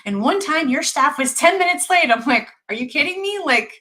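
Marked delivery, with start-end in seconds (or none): 0.0-2.1 higher, louder mock-indignant patient; irritated complaint. Patient griping to the doctor about lateness, irritated. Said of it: "a woman that was irritated with me". 2.7-3.4 high-pitched, exasperated disbelief; incredulous retort. Retold or imitated. Doctor reacting to a patient's complaint, incredulous.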